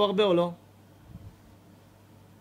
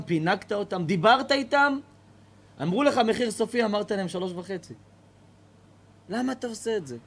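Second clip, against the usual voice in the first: agitated reproach; 3 semitones higher, 5 dB louder than usual. Acted. Neighbour scolds the old man.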